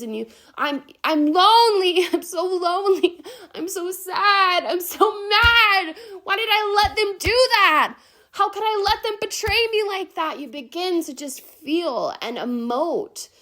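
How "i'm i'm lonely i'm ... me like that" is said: high, loud, distraught crying-out; anguished wailing outburst. Acted. You mirror her sounds of betrayal, wailing in anguish. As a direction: pour out the hurt, pitched way up. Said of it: "they're mirroring her sounds".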